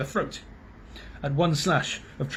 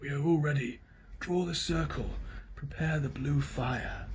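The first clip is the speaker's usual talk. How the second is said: grey voice; 9 dB quieter than usual. A narrator's reading. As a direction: murmur the ritual order. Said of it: "said the grey voice".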